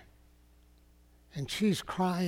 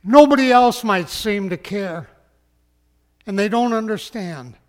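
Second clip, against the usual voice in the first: raised, loud pulpit voice; emphatic preaching; 5 semitones higher, 9 dB louder than usual. Real, not acted. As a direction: lift it high and strong to the congregation. Preacher exhorts the grieving listener.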